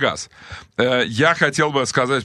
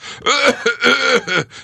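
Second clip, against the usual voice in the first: high, strained put-on hacking; long mock grunting cough to mask farting; hammed-up old man's groaning cough; deliberate old-man grunting; 12 semitones higher, 4 dB louder than usual. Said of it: "кряхтя специально, чтобы заглушить отходящие газы".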